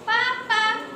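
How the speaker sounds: put-on loud, high baby shout of papa. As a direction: call out like a toddler. Baby calls out.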